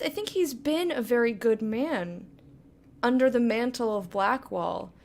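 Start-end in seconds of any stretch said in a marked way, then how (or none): none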